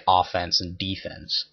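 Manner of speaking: put-on amateur American football accent on OFFENSE and DEFENSE; terrible American accent. Gamer thinking of American football, terrible American accent. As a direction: do a bad American "offense and defense", first syllables stressed. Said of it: "that's my terrible American accent".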